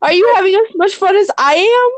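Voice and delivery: high, giddy, slowed joyride cheer; gleeful squeal. Acted. Player enjoying the wild wagon ride, giddy and high. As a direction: squeal like it's a fairground ride. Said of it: "Can I just be saying".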